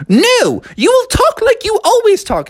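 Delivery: very high, strict nanny; Mary Poppins. Acted. Nanny forbidding Froggy's accent, high and strict. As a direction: trill a firm order.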